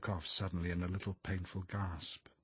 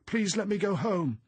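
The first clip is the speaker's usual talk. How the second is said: high, loud tearful pleading; distressed, wailing plea; sobbing; 12 semitones higher, 8 dB louder than usual. A narrator's reading. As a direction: beg desperately, wailing like a child.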